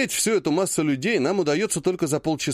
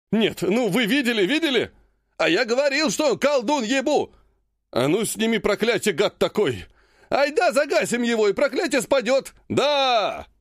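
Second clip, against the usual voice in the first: angry crowd voices; 9 semitones higher than usual. Acted. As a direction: shout like an enraged rabble. Angry crowd of players shouting.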